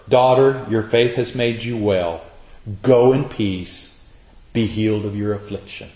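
Jesus blessing the healed woman, tender and soft. Acted. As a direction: speak gently to a frightened daughter.

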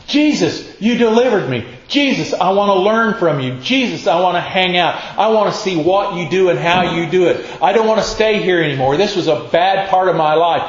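a man pleading to tag along. Acted. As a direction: beg to follow him, pressing. Man begging Jesus to come.

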